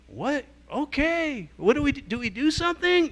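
high, flustered, tumbling questions; flustered surprise. Retold or imitated. Shopkeepers suddenly offered a blessing, high flustered questions. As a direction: caught off guard and unsure what to do.

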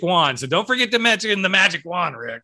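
hammed-up publicist reminder; imitating PR person. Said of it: "your P R person said".

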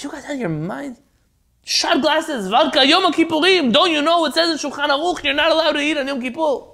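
high, loud indignant protest; outraged protest. Retold or imitated. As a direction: scold them for breaking the fast. Leibel protests the vodka.

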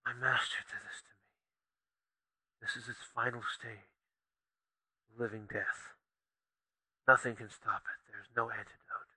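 soft, hoarse whisper of a dying man; barely breathing out the words, resigned; dying man's whisper. Acted. Dying poisoned man speaks.

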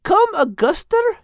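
high, baffled little bird; high bird voice, puzzled; Bethu's voice. Acted. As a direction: ask it high and confused. Bethu confused.

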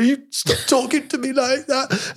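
high, slow, whiny mock sob; tearful sister. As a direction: imitate a sister in tears, pleading and hurt. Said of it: "they'd just be like".

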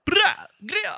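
put-on very high, silly accented "Greer"; odd accent. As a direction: fumble the made-up accent in a squeak. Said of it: "what kind of accent that was".

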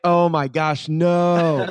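shocked denial. Retold or imitated. Shocked at the marijuana question.

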